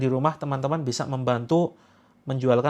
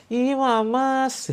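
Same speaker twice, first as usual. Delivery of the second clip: much higher, slow, drawn-out coy squeal; coy wife; 10 semitones higher, much slower than usual. Pretend. Wife teasing her doting husband back, coy and high. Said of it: "Nanti tahu-tahu istrinya".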